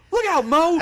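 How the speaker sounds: high, loud warning shout; panicked commentator cry; mock panicked yell. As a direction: yell the warning like a frantic announcer. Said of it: "hearing Jerry Lawler say".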